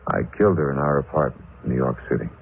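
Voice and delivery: fast, low blurted confession; rushed confession. Acted. Killer confessing to the desk sergeant, fast and low. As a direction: get it all out at once.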